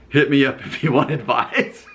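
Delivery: reading through laughter; can't keep from laughing. Real, not acted.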